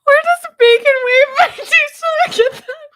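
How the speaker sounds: high, loud and tearful with laughter; laughing to tears. Real, not acted. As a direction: barely get the question out through helpless laughter. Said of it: "I don't know why I'm crying".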